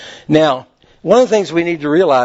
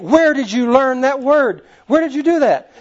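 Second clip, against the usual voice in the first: imitated scolding mother; 8 semitones higher than usual. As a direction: play the outraged mom interrogating her boy. Mother interrogating her son, retold.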